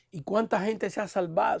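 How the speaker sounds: ordinary talk, nothing marked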